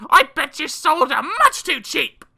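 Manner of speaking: high, loud scolding mother; a furious mum telling off her son; angry mother. A narrator's reading. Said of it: "The mother said".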